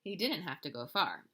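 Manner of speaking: plain reading voice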